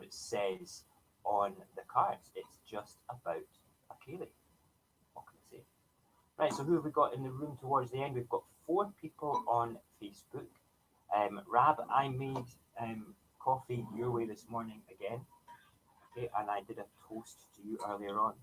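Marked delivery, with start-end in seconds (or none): none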